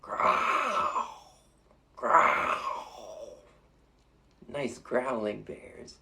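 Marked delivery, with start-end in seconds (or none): 0.0-1.1 high breathy polar bear growl; playful bear growl. Shown as a demonstration. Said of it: "Can you show your teeth and growl like a polar bear?". 2.0-3.4 second bear growl; playful bear growl. Shown as a demonstration. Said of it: "growl like a polar bear".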